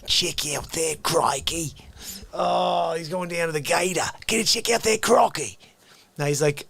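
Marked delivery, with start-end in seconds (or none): 0.1-1.8 put-on Australian accent. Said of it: "And I'm like". 4.2-5.6 put-on Australian accent. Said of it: "can you do an Australian".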